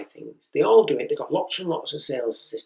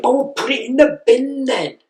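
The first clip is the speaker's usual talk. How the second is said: much higher, louder, fed-up outburst; losing temper; 12 semitones higher, 7 dB louder than usual. Retold or imitated. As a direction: blow up at the child. Father losing temper again.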